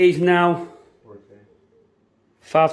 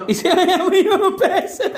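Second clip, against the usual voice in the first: laughing; 4 dB louder, much slower than usual. Real, not acted. Laughing mockery.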